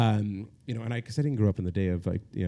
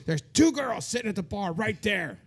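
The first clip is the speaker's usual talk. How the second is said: friend's voice; 9 semitones higher than usual. Retold or imitated. Friend pushing him toward the bar.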